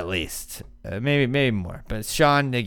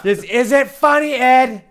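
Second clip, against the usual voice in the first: high, loud mock plea; aggrieved quarterback; 13 semitones higher, 8 dB louder, slower than usual. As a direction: drag out an indignant complaint. Quarterback complaining to the coach.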